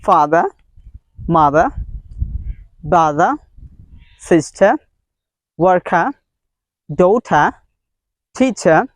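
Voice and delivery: word list with each final "-er" as an open "uh"; non-rhotic endings on "father" through "teacher", one word at a time; dropped R. Shown as a demonstration. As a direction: say "father, mother, brother, sister, worker, daughter, teacher" without final R. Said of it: "there are so many sounds they drop".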